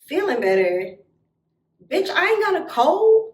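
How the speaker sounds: loud, high, scornful comeback; incredulous retort. Retold or imitated. Betrayed girl rejects friend's soothing, loudly indignant. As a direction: throw it back in disbelief. Scornful echo in retold quarrel.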